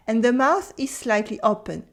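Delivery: ordinary talk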